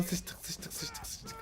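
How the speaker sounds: panicked whisper. Real, not acted. Panic as guards attack.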